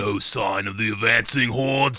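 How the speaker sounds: slow, drawn-out British echo of a line; stretched-vowel British accent. Shown as a demonstration. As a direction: echo it slowly in an English accent. Interviewer repeats line in British accent.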